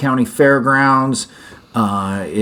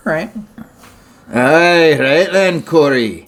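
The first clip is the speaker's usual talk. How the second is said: put-on Scottish accent; 3 semitones higher, slower than usual. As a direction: greet him in a Scottish accent. Both in kilt, Scottish banter.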